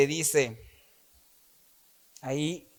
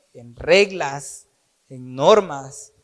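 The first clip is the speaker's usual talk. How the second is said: slow emphasis; 3 semitones lower, slower than usual. Acted.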